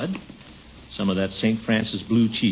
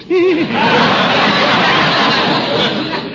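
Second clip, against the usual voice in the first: giggling laugh; 6 dB louder than usual. Acted. Gildy laughs at Judge.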